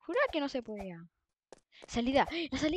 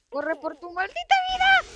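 louder, higher panicked urging; being chased; 6 semitones higher, 7 dB louder than usual. Real, not acted. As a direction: yell to run for your life. Fleeing a chaser in game.

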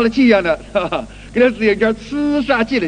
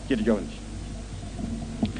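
soft aside; 10 semitones lower, 14 dB quieter, much slower than usual. Acted.

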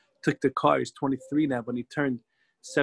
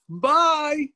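bright, high and louder bye; cheery bye; 12 semitones higher, 5 dB louder than usual. Retold or imitated. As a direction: wave them off with glee. King cheerfully waves back.

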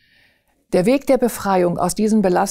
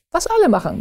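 higher repeat; 9 semitones higher, faster than usual. Retold or imitated. Same words repeated higher.

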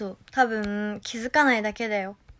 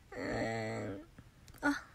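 usual speaking voice

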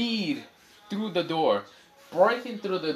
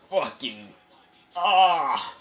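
pained groan; 3 semitones higher, 6 dB louder, much slower than usual. Acted. Itachi in pain after punch.

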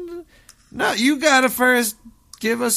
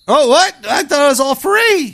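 mock surprise; 3 semitones higher, 8 dB louder than usual. Feigned shock, told it isn't free.

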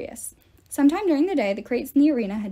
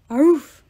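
loud, happy bark; hammed-up loud woof; playful dog bark; 4 semitones higher, 11 dB louder than usual. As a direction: bark like a big friendly dog. Hellhound barks happily.

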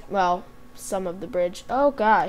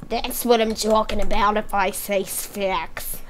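raspy whispered smoker rasp; slower than usual. Acted. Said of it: "You sound like a raspy smoker".

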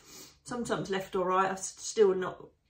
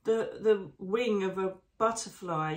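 ordinary talk

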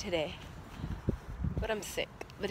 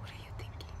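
whispered; 12 dB quieter, faster than usual. Retold or imitated. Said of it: "be like".

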